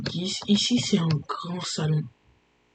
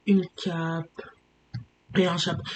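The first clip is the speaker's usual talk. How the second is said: usual speaking voice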